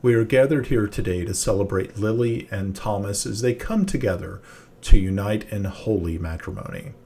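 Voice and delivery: ordinary delivery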